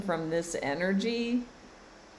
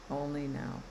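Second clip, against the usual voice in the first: "Only now." repeated softer, letting it settle; softer echo; 7 dB quieter than usual. Shown as a demonstration. Coach echoing her own words to viewers, softer. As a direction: echo "Only now." more quietly, like an afterthought.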